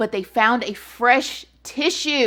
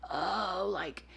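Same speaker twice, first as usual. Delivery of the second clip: a hint of a feeble old man, much softer; frail old man; 4 semitones lower, 15 dB quieter than usual. Killer faking frailty in court, lightly voiced feeble old man. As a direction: let it drain out weak and tired. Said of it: "he's answering like".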